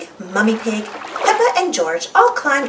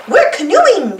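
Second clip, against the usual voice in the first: high, louder call; shout; 6 semitones higher, 6 dB louder, slower than usual. Acted. Emily shouts back to the other boats, high and loud. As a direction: call it out gleefully. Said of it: "shouts Emily".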